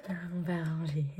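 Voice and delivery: breathy whispered reassurance. Real, not acted. Vloggeuse qui se rassure seule en chuchotant. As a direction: whisper it to yourself.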